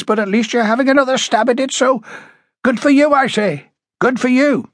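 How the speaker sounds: high-pitched, indignant character voice; a touch of anger, pitched high; angry. A narrator's reading. Woman chiding police for reopening the case late, angry. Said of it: "you sound angry".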